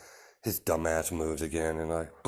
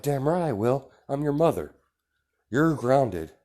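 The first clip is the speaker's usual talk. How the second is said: raised, louder voice of an angry mother; stern scolding; 5 semitones higher, 5 dB louder than usual. Acted. Mother grounding her defiant son, stern scolding. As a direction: lay down the law, higher and louder.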